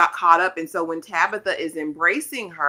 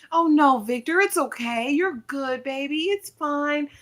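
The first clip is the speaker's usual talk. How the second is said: higher, soothing voice as Tabitha; Tabitha consoling; 8 semitones higher than usual. Retold or imitated. Tabitha hugging and consoling Victor, higher tender coo. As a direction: coo over him like a comforting woman.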